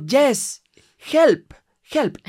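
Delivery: hammed-up helpless tourist begging; mock desperate plea. Stranded tourist hamming up a plea to the woman.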